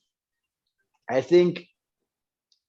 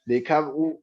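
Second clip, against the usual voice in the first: normal talking voice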